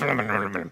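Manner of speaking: low, soft wordless grumble; mumbled grousing noises; grumbling under breath. Retold or imitated. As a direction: mutter nonsense crossly to yourself. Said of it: "I'm grumbling under my breath".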